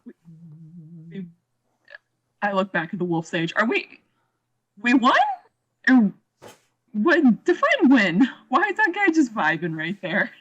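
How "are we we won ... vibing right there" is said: rising, louder disbelief; incredulous. Real, not acted. Player demands what winning means, incredulous and louder. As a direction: push back in amazed confusion. Confused player questioning dragon.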